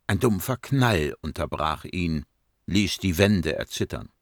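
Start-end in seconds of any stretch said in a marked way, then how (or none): none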